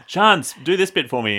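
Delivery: teasing pretend plea to a co-host; playful mock request. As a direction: playfully put on a favour-asking voice. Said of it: "Be like, be like".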